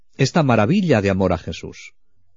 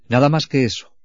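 ordinary talk

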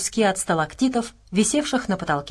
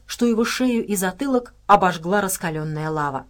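usual speaking voice

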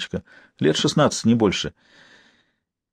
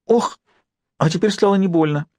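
put-on relieved girl; 7 semitones higher than usual. A narrator's reading. Girl relieved after pain.

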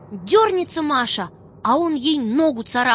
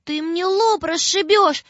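high, pleading, close to crying; little girl pleading in tears; tearful plea; 7 semitones higher than usual. A narrator's reading. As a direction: beg the unseen beast through tears. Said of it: "опять заплакала и говорит".

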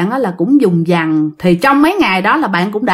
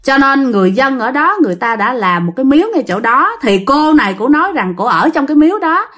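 ordinary talk, nothing marked

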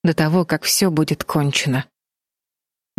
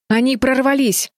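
high, startled exclamation; alarmed cry; 8 semitones higher than usual. A narrator's reading. Флис warns the others the guards broke in, alarmed cry. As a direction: cry out that danger has broken through. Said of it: "вскрикнула Флис".

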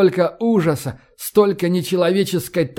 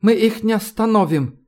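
higher-pitched, at a loss; bewildered muttering; 6 semitones higher than usual. A narrator's reading. Professor facing an unstoppable swarm, lost and bewildered. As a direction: say it helplessly, not raising volume. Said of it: "растерянно пробормотал Вессенберг".

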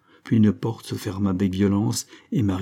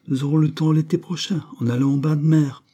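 airy, higher-pitched brush-off; nonchalant; 7 semitones higher than usual. A narrator's reading. Mother putting off her daughter's wish till summer, nonchalant. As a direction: toss it off lightly, up high. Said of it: "dit nonchalamment à madame Lagarde".

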